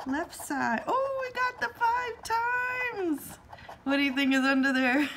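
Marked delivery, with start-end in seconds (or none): 0.9-3.4 sudden high pitched jump; surprised. Real, not acted. Finds a multiplier on the ticket. 3.8-5.1 quick and louder; excited. Real, not acted. Excited about the hidden prize.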